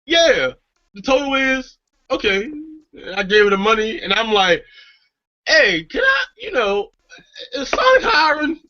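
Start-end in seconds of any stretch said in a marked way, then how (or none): 0.1-2.4 awkward freeze. Retold or imitated. Acting himself frozen before the girl. 5.4-8.6 high, halting, timid-sounding question; sheepish botched approach. Retold or imitated. Frozen guy asking the Sonic girl, high and sheepish.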